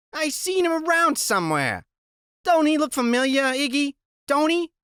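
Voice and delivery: a wary tough woman; raspy woman voice. Acted. Narrator voices Loretta.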